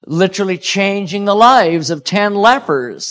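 normal talking voice

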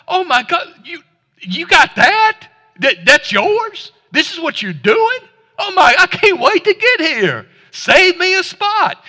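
high, loud, thrilled voice of someone who sees heaven; amazed excitement. Pretend. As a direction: gasp with wonder and joy at what they have. Imagining seeing loved ones in heaven.

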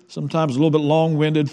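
normal talking voice

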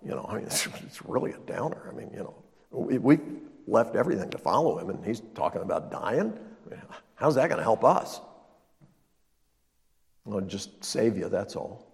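mock disciples grumbling softly. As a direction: grumble low and fed up. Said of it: "they're like".

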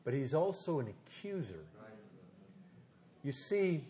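quiet assurance with long pauses; gentle pastoral preaching. Real, not acted. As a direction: speak softly and personally to the congregation. Preaches softly, pastoral application.

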